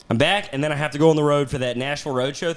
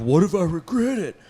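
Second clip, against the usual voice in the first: slightly raised, panicky tearful question; mock panic; 3 semitones higher than usual. Woman suddenly doubting her choice, mock panic.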